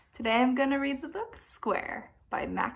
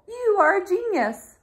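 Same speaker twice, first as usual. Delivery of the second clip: higher, louder praise; admiring; 9 semitones higher, 6 dB louder than usual. A narrator's reading. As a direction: gush it with delight. Circle praising Square.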